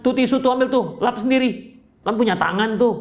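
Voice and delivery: high-pitched, impatient brush-off; mock brusque dismissal. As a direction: play an uncaring husband waving off tears. Acted uncaring husband scolds crying wife.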